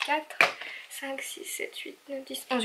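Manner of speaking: very soft, half-whispered count; barely audible tallying; counting under her breath. Real, not acted. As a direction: count quietly to yourself. Counting items to herself.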